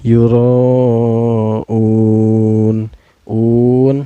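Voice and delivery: "Yura'un" with RA and UN held long; elongated vowels. Shown as a demonstration. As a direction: chant "Yura'un", stretching both long vowels. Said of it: "dipanjangkan boleh empat harakat". ("Yura'un" dibaca panjang mengalun)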